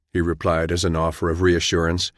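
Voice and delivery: ordinary delivery, nothing marked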